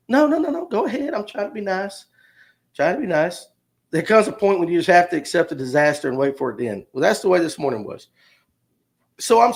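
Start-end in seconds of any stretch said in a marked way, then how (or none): 0.1-3.5 much higher, falsetto-ish, softening to a murmur; sugary fake-nice, trailing off; overly polite. Teller mocks his own forced politeness in line, high-pitched. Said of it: "And I'm like".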